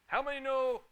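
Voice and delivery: a hint of the old pastor asking; imitating Pastor Huck. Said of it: "Pastor Huck ... says this".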